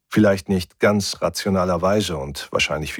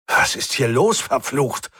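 raised, agitated cry; shouting; 6 semitones higher than usual. A narrator's reading. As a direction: demand answers in a high yell. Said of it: "schrie ich". (aufgebracht geschrien)

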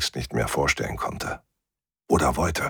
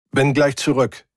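raised-pitch call over the shoulder; 6 semitones higher than usual. A narrator's reading. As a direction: call it out while hurrying off. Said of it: "rief ich". (im Weggehen gerufen)

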